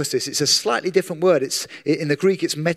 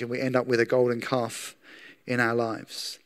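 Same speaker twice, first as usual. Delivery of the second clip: hushed warning; 4 semitones lower, 7 dB quieter than usual. Acted. Preacher drops voice on warning.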